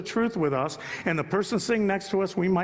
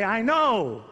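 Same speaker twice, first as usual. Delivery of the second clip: lightly mocked know-it-all, pitched up; know-it-all fool; 6 semitones higher, 3 dB louder than usual. Preacher imitating fools who refuse advice, lightly know-it-all. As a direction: put on a hint of a fool's cocksureness. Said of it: "fools say".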